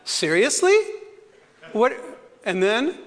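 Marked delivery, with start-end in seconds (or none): 0.0-1.9 incredulous question. Retold or imitated. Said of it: "I said out loud in my car".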